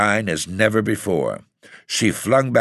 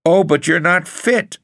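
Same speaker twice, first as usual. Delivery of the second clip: high, louder protest; 12 semitones higher, 5 dB louder than usual. A narrator's reading. Ethel stopping her aunt getting up, protesting. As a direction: cry out to stop her. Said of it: "Ethel protested".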